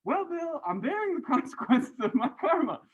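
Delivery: high, mock-pompous student reply with a laugh; mock student answer. Student answering a concerned teacher, hammed-up high mock reply. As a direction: play a student giving an absurdly stiff answer. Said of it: "Are you gonna say".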